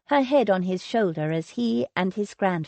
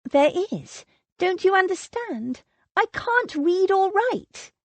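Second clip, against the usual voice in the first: much higher, pleading woman; young woman voice; 10 semitones higher than usual. A narrator's reading. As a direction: make him understand. Lily insists, higher.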